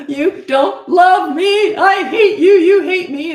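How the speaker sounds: put-on high, loud, drawn-out wail of a furious kid; hammed-up tantrum yell, dragging out each word; mock child tantrum. Coach imitating a child mid-tantrum, wailing angrily at a parent. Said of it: "talking like that during a tantrum or during anger".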